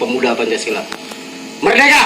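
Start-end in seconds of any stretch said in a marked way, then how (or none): 1.6-2.0 high shouted rally call; rally speech. Real, not acted. Speaker leads crowd chant. (seruan orasi lantang)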